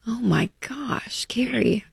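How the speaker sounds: breathless whispered dismay, slowed; shocked whisper. Real, not acted. Host whispering in shock at the widow's news. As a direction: let the shock come out as a whisper.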